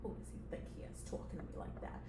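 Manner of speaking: fast, whispered complaint; hushed, indignant aside; resentful muttering. Acted. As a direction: mutter rapidly under your breath. Assistant complains under breath.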